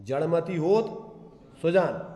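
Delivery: slow जड़मति होत held up, pause, then Sujan falling; teacher showing the break after होत; comma before Sujan. Shown as a demonstration. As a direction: join जड़मति होत and set Sujan apart. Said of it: "इधर comma लगाओ, इधर से बोलो".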